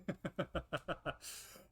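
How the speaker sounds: quick run of light chuckles. Real, not acted. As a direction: chuckle along at your own story. Amused at own recap.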